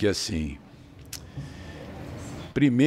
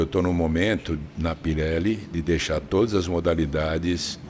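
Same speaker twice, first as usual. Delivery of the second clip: ordinary talk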